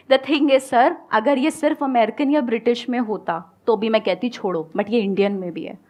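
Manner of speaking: ordinary delivery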